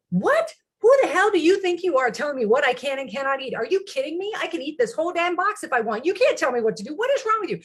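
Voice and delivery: high-pitched indignant outburst, retold; fly off the handle at the husband, hammed-up; screaming mad retort. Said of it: "screaming mad in-, instantly".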